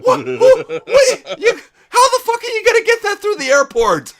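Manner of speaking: high, incredulous laughing; laughing disbelief. Real, not acted. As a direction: squeal in amused disbelief.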